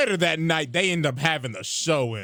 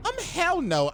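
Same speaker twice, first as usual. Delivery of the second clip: put-on high-pitched girly no; put-on squeaky refusal; mock refusal; 13 semitones higher than usual. Ty Lee refuses after near death.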